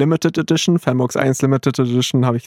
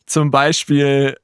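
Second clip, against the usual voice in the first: normal talking voice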